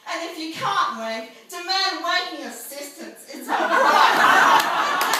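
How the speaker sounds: high, loud call building to a shout; rallying demand. Acted. Poet demanding rights from the crowd, loudly. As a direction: raise the pitch and demand it from the crowd.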